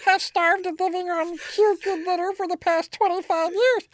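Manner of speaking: hammed-up monster voice breaking into a laugh; strained, distorted cartoon monster reading. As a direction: garble it like a puppet monster in a bad accent. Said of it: "Monster needs a hug".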